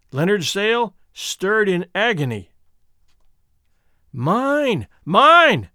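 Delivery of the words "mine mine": high, rising possessive shout; drawn-out ringing claim; loud cry. A narrator's reading. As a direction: cry out, louder the second time. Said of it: "cried a loud voice".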